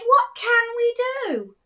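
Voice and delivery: high, loud desperate cry; villagers crying out. Acted. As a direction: cry out for help as a worried crowd. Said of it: "till the villagers cried".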